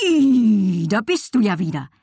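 loud, high neigh sliding down into words; whinny the name with delight; joyful whinnying horse. A narrator's reading. Horse greets the girl again, whinnying joyfully. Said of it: "wieherte er freudig".

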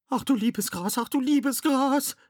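very high squealing voice; high-pitched, flustered squeak; panicked squeal. A narrator's reading. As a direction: squeal in alarm as you're grabbed. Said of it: "quiekte Jasmine".

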